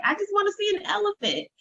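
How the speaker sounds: put-on high, quick little-kid voice; little boy. Mother imitating her son at the zoo, high and quick. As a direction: chirp it like an eager small child. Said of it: "my little boy who's like".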